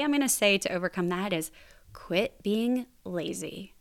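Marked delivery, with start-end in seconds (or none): 1.9-3.7 slow, measured delivery of blunt advice; slow deliberate advice. Shown as a demonstration. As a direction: spell it out slowly, without raising the voice. States blunt advice, then repeats louder.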